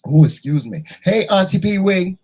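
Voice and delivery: ordinary talk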